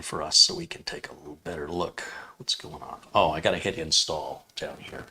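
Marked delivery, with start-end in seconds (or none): none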